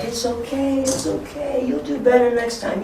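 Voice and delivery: high, soft reassurance; encouraging. Pretend. Teacher to kids who lose, gently encouraging in a high voice. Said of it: "be encouraging still to them".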